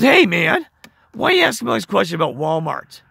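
mock indignant challenge to a nosy questioner; pissy. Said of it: "He gets really pissy".

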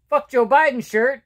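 ordinary talk

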